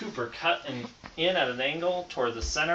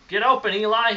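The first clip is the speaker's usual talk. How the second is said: louder, higher call across the yard; shouting; 7 semitones higher, 7 dB louder than usual. A narrator's reading. Peyton shouting to Eli across the yard, louder and higher. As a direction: yell it to a teammate going long. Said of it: "he shouted".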